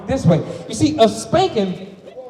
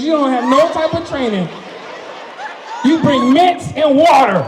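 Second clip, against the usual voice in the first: louder, higher, worked-up rant; angry rant; 4 semitones higher, 7 dB louder than usual. Real, not acted. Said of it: "I was a little angry".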